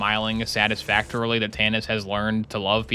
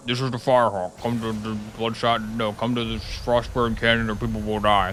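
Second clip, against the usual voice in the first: modulated voice. Retold or imitated. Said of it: "She has her voice modulated".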